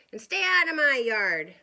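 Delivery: loud cross retort; yelled back. Acted. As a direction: yell it back, annoyed. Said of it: "Hank yelled back".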